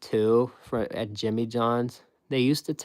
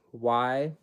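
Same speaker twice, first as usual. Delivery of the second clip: ordinary talk, nothing marked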